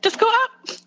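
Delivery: "Just go up" soaring very high at the end; upspeak. Shown as a demonstration. Canadian agreeing to sound politer, "Just go up" in exaggerated upspeak. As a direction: lift "up" into a high question-like rise. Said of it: "go up at the end of our sentences".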